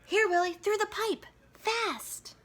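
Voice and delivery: high, urgent call to the dog; boy urging his dog through the pipe. Acted. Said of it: "he spoke".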